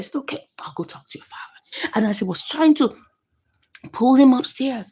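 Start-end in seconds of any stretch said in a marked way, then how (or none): 0.2-1.9 whisper. Retold or imitated. Wife sneaks off, hushed.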